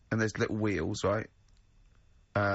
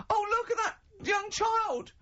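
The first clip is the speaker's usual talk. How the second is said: hammed-up fretful bystander, much higher; squeaky mock-worried onlooker voice, pitched way up; panicky bystander. Bystanders voiced in Frog Boy story.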